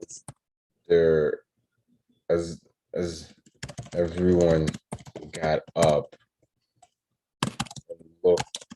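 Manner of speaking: word-by-word story composing, long pauses; slow dictation. Real, not acted. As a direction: dictate it haltingly, as if writing along.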